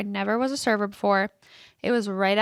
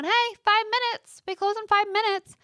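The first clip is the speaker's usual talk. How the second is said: calling out closing time; 10 semitones higher than usual. Retold or imitated. Gym worker calling members out at closing time, high call. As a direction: sing it out to the whole gym. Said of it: "telling everyone".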